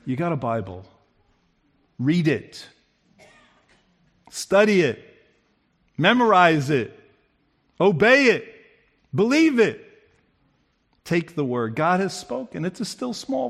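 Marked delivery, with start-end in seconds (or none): 0.1-11.7 God's commands. Acted. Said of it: "he's like".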